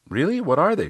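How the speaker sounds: high-pitched curious client; Riley. Retold or imitated. Client asking coach about growth areas, high voice. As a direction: ask eagerly as the client, pitched well up. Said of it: "she said".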